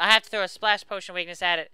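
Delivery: ordinary talk, nothing marked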